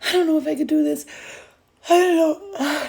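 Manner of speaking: high, loud mock-whimper; hammed-up tearful doubt, pitched high; self-doubting whine. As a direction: whimper it like someone pretending they can't cope. Acts out a doubting listener.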